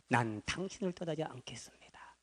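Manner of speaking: whispered, low and soft; hushed vow. Acted. Elisha whispering his vow never to leave Elijah. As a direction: breathe the vow like a secret oath.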